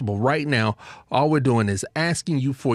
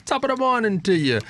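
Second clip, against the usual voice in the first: hammed-up fake Irish brogue, quick and higher; a jokey stage Irishman greeting for Saint Patrick's Day; playful Irish accent, not convincing; bad Irish accent; 8 semitones higher, faster than usual. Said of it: "in [name]'s bad Irish accent".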